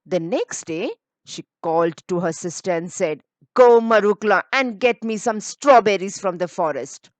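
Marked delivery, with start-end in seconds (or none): 3.5-7.1 bossy order. Acted. Spiteful sister giving orders.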